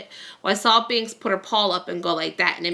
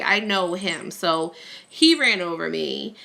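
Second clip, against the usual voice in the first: ordinary talk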